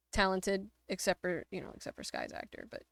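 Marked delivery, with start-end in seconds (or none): 1.5-2.7 teasing whispered jab; whispered aside. Real, not acted. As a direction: whisper it behind your hand. Teasing aside about Sky's actor.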